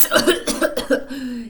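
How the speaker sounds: raspy, hammed-up cough; character cough. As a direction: cough like a choking creature. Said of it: "He coughed and he whiffed".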